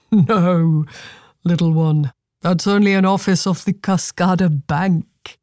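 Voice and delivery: lightly voiced passing gentleman; a touch of indulgent amusement; kindly amused man. A narrator's reading. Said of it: "chuckled, though not unkindly".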